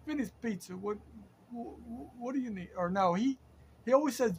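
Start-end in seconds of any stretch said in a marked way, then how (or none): 0.1-2.7 lightly imitated Sicilian pizza man, higher, answering the phone; a hint of a thick Sicilian accent, brusque phone greeting. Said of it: "with a real heavy Sicilian accent".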